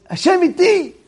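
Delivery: high-pitched imitation of a wild cry of faith; wild outcry. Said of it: "she's going like wild".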